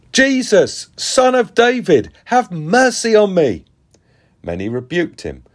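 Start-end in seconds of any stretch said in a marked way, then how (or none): 0.1-3.6 high-pitched cry calling out for mercy; desperate shout. A narrator's reading. Blind beggar to Jesus passing by, shouting. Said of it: "he began to shout".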